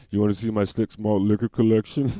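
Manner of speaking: put-on old man's come-on.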